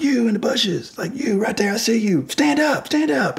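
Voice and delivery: high, forceful shouted commands; calling out the man hiding in the bushes; big commanding voice. Retold or imitated. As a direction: make yourself sound big and in charge. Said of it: "my big voice".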